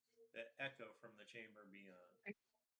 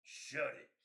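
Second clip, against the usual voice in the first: louder, gruff barked command; harsh goblin boss; 3 semitones higher, 11 dB louder than usual. Acted. Goblin boss silencing the squabbling goblins with a loud gruff bark. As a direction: cut the bickering dead. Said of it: "a deeper, harsher voice".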